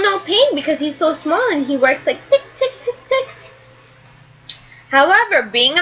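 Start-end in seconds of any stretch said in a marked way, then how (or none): 2.5-3.3 ticking. Shown as a demonstration. Ticking sound of small drill.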